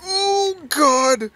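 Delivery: very high, loud panicked scream; terrified cry. Real, not acted. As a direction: shriek in real fright. Monster appears, he panics.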